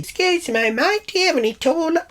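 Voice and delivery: high-pitched polite customer voice; mock-sweet high query to a shop worker; polite enquiry. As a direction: ask it in an exaggerated high, sing-out voice. Acted polite question to shop worker.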